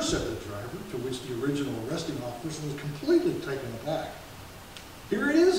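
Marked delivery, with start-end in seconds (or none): 5.1-5.6 high, loud cheerful reply; grinning. Acted. Driver cheerfully hands the captain his license, grinning. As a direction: hand it over with a grin. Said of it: "he said, grinning".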